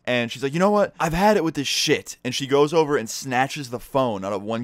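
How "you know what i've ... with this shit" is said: higher, louder retold outburst; fed-up outburst. Teacher confronts students filming a fight, fed-up outburst. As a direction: act out a teacher reaching the limit.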